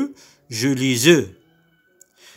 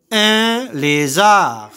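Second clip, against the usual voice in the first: "Un lézard" stretched slowly and a little higher; slow model word; 4 semitones higher, slower than usual. Shown as a demonstration. Teacher modelling "Un lézard" slowly for pupils. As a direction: draw out "Un lézard" for the class. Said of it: "Je répète".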